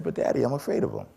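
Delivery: put-on high, quick little-kid plea; a child begging his dad not to send him back, high and hurried; scared child pleading. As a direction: plead like a frightened six-year-old.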